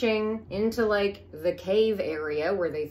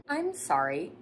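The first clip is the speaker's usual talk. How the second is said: normal talking voice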